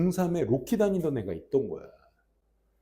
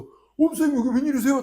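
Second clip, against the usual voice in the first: put-on high, louder, surprised kid voice; surprised kids greeting; 11 semitones higher, 8 dB louder than usual. Students greet teacher at school, high surprised call. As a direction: greet him like a startled thrilled student. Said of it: "딱 그러는 거야".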